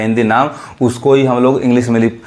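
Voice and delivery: ordinary talk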